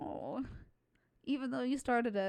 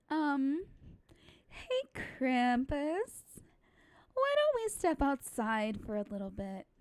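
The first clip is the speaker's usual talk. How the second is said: put-on bright customer service girl voice; chirpy sorority girl, lightly voiced; 6 semitones higher, 4 dB louder than usual. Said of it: "Monica's my customer service slash sorority girl voice".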